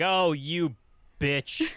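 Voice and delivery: lightly hammed-up demon mother's insult; mock angry villain. Demon mom finding the wheelchair girl, mock outrage.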